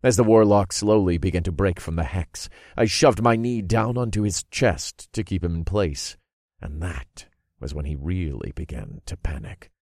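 ordinary delivery, nothing marked

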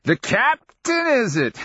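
high, slow, mocking old man; cackling reproach. A narrator's reading. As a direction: cackle it back as a scolding. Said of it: "cackling reproach".

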